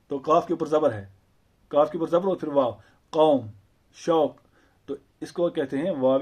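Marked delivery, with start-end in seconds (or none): none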